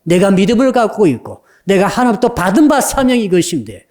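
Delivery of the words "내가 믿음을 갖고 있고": ordinary delivery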